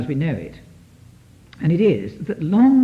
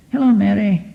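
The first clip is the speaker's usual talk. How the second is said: friendly boss greeting; 4 semitones higher, 5 dB louder than usual. Retold or imitated. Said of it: "If he says".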